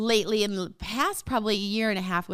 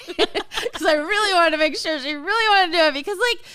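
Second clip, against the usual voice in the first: laughing; 8 semitones higher, 8 dB louder than usual. Real, not acted. Laughing while retelling.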